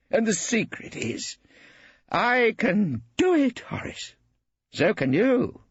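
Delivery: high, grand, self-delighted braggart; boastful old artist. A narrator's reading. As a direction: show off your career. Brittling boasting of his talent.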